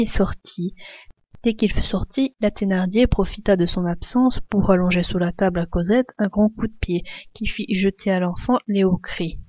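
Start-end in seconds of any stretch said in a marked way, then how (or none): none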